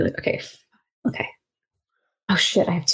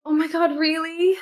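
higher-pitched, disbelieving gratitude; acted grateful surprise; 8 semitones higher than usual. Frazzled character offered a beer by strangers, gratefully surprised. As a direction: overdo the relieved surprise, pitched up.

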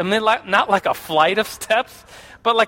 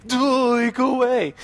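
mock bratty girl; 5 semitones higher, slower than usual. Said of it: "and it was like".

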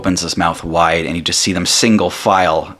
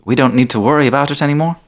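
higher, questioning character voice; Tandy checking; 8 semitones higher than usual. Acted. Tandy looking round at each of them, high questioning. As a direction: ask it high, seeking confirmation. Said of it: "She says".

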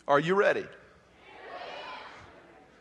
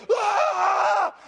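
sudden high shouted Ah; demo scream; 12 semitones higher, 5 dB louder than usual. Shown as a demonstration. Preacher shows the workshop crowd how to scream. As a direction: let out a wild high yell. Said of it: "I want you to go".

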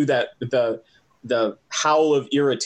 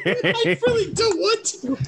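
laughing. Real, not acted. Laughing reluctance at attacking.

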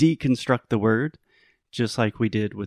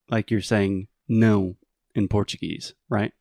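ordinary delivery

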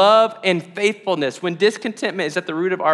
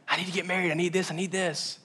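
quiet retold friend; 11 dB quieter than usual. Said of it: "a friend that's like".

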